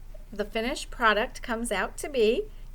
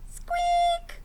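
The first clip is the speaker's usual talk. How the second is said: tiny squeaky mouse sound; high mouse squeak. Acted. Mouse noise in story.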